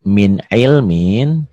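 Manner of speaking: min ilmin drawn out very slowly, each sound clear; slow recitation. Shown as a demonstration. Teacher reciting min ilmin slowly for students to copy. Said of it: "ditulisnya min ilmin".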